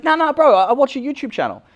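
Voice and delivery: high, loud eager bloke; insistent fan voice. Retold or imitated. The fan insists he watches the channel, high and loud. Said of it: "He's like".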